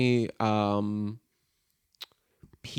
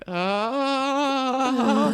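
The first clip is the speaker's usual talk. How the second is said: long, high held "aah"; mock scared cry; 13 semitones higher than usual. Player looks up at monster overhead, frightened wail. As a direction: imitate a wail of fright, pitched way up. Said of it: "you're like".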